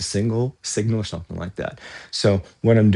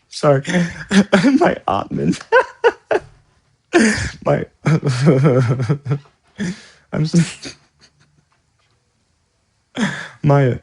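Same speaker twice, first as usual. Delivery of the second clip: real giggles breaking through the words; can't get past the slip for laughing; laughing fit; 7 semitones higher, 4 dB louder than usual. Real, not acted. Laughing at mixing up ottoman and observer.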